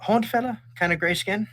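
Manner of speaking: high, lightly voiced young butcher remembering; recognizing butcher Aval. GM voicing the young butcher to the player, high and curious. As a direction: pitch up as it dawns on him. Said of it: "He's like".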